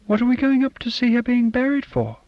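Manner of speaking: put-on a child whining at her dad, pitched high; small girl voice. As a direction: voice a fussy little girl. Child Sonia questions again.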